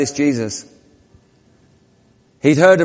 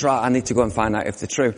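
quiet inner thought; 6 semitones lower, 8 dB quieter, faster than usual. Acted. Luke's inner thought voiced.

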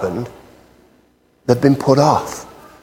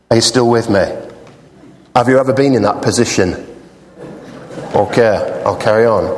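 ordinary delivery

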